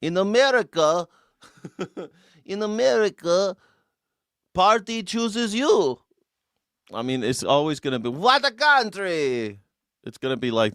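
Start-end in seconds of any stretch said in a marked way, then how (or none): 0.0-6.0 put-on broad Russian accent, punchline drawn out; Yakov Smirnoff. Streamer telling a Yakov Smirnoff joke, high Russian accent. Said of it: "a Yakov Smirnoff joke". 8.1-9.6 put-on high Russian accent, slow catchphrase; Yakov Smirnoff. Streamer doing the comic's catchphrase, slow high Russian accent. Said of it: "a Yakov Smirnoff joke".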